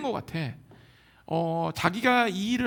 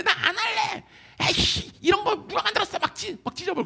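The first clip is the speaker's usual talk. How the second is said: put-on whiny child tantrum; 12 semitones higher than usual. Child shouting at the parent over math, high whining tantrum. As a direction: throw a bratty fit over homework.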